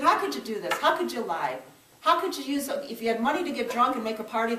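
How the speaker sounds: retold yelling confrontation; angry yell. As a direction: shout the accusation at him. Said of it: "So I yelled at him".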